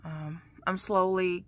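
normal talking voice

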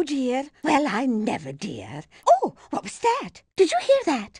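usual speaking voice

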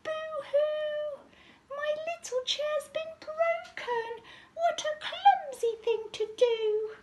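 very high squeaky mock wail; tiny bear's hammed-up boo-hoo; Baby Bear. A narrator's reading. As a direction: squeal it like a tearful cub. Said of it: "Baby Bear squeaked".

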